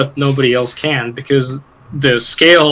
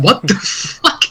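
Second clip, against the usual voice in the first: baffled; 6 semitones higher, slower than usual. Retold or imitated. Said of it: "it's just like".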